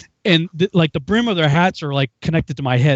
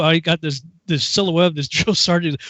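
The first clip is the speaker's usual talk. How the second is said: usual speaking voice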